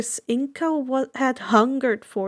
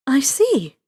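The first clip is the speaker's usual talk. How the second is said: whisper; 2 semitones higher, 4 dB louder than usual. Acted. Inko answers softly.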